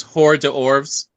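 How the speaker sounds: hors d'oeuvres sounded out slowly as "hor-de-orves"; mispronounced. Shown as a demonstration. Mocking people who can't pronounce it, slow mispronunciation "hor-de-orves". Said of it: "they can't pronounce it".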